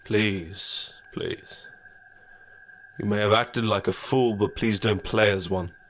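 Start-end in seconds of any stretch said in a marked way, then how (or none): 1.1-1.7 unvoiced creeping whisper; dark whisper behind you. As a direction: breathe it right behind them. Shadow drops to whisper.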